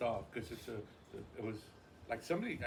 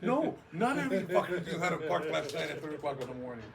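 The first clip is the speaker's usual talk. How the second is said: louder, half-laughing comeback; laughing mock retort; 5 semitones higher, 6 dB louder than usual. Laughing comeback to the neighbours who mocked his parking. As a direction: fire back at them, amused.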